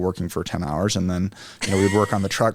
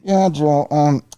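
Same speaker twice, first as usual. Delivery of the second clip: muffled voicemail voice; 6 semitones higher, 3 dB louder than usual. Retold or imitated. Mechanic's voicemail imitated.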